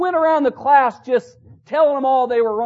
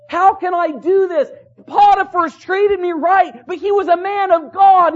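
high, loud dramatized protest; impassioned self-questioning; 8 semitones higher, 6 dB louder than usual. Retold or imitated. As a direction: preach Joseph's question out forcefully. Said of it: "Joseph asking the question to himself".

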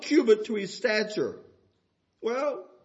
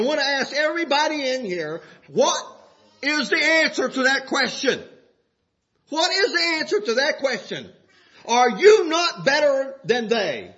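preaching with a laugh; 6 semitones higher, 5 dB louder than usual. Acted. Preacher asking everybody in here the answer, laughing. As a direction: push the question with a grin.

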